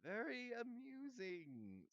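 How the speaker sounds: slow, high, drawn-out sarcasm; dark. A narrator's reading. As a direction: draw it out with sour disdain. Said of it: "said nearly headless Nick darkly".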